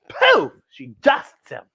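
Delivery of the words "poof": much higher, excited poof; poof sound effect. Acted. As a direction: pop the word like a magic puff. Vampire turned to dust.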